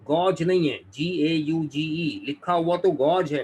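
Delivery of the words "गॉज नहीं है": insistent correction. Real, not acted. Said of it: "teacher correcting insistently".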